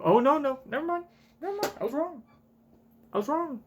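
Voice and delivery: high, excited backtracking; sudden realization. Real, not acted. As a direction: squeal the retraction. Realizes theory is wrong.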